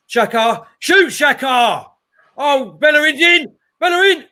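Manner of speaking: roaring the players on as the chance opens; excited shout. Real, not acted. Live chance, fan commentating on match.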